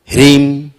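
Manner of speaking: ह्रीम, closing on a lips-shut m, not n; ending in m. Shown as a demonstration. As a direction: say ह्रीम, with the mouth shut at the end. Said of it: "तो ह्रीन नहीं पढ़ना है".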